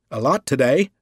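a touch of cheer, lightly voiced; cheerfully. A narrator's reading. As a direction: sound pleased with the pile of mail. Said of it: "Visey told him cheerfully".

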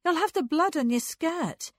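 a hint of a stern older sister; sharp. A narrator's reading. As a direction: warn her sister off crisply. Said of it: "said Frances sharply".

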